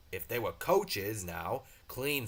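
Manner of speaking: ordinary delivery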